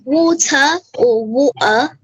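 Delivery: "Water" with no R at the end, then "water" with a glottal stop for the t; British water. Shown as a demonstration. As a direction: show both British ways of saying "water". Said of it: "they say the word like this".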